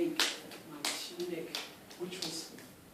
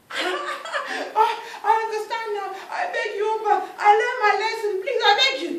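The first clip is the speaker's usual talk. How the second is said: high, loud desperate pleading; frantic begging; 12 semitones higher, 19 dB louder than usual. Acted. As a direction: plead to be freed. Oba repents.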